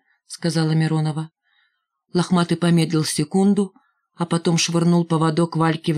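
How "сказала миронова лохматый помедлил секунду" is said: ordinary delivery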